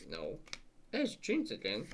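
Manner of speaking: normal talking voice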